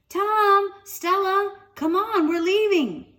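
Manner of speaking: high, loud call from far off; mother calling. Acted. Mother to Tom and Stella, loud call. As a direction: summon the kids home.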